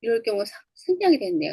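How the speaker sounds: normal talking voice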